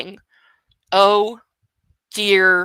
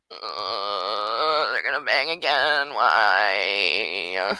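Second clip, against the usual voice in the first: dismayed groan; 11 semitones lower, 5 dB quieter, much slower than usual. Real, not acted. Groaning at the next sex scene.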